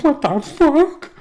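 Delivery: high, slow, loud fake wail; mock crying. Sensitive Bill upset by German dad, mock wail. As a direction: wail like an oversensitive boy. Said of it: "he was walking around crying".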